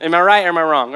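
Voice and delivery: ordinary delivery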